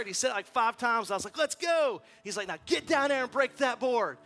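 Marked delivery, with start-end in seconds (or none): 1.4-2.0 loud, high rallying yell; cheering dad. Retold or imitated. Dad cheering his son on, loud excited shout. 2.5-4.2 high, loud coach's command; urging shout. Retold or imitated. Martial arts master sending boy to the board, urgent shout.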